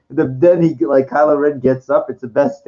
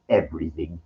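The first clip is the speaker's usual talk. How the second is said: Palpatine impression; 10 semitones lower, 8 dB quieter than usual. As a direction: lower and softer, a hushed mock Emperor. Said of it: "he goes".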